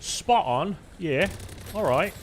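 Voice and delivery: showing off a hammed-up English accent. Shown as a demonstration. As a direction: put on a swooping English accent. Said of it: "Did it again".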